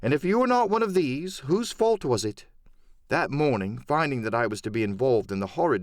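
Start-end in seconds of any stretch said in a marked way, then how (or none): 0.0-2.5 a lightly raised, earnest doctor; doctor's voice. Acted. Doctor answering Silver, firm and earnest. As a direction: defend his choice firmly. Doctor's quoted speech ends.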